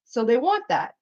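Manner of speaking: ordinary talk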